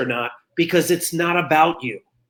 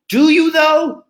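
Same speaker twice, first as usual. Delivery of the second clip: high, loud incredulous "do you though"; skeptical challenge; 11 semitones higher, 5 dB louder, slower than usual. Retold or imitated. Retorting to someone who claims they want to be an ally, high and loud with disbelief. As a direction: pitch it up and doubt them out loud.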